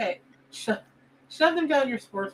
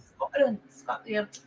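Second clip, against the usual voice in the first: put-on Scottish accent; 5 dB quieter, slower than usual. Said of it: "I gotta get that accent better".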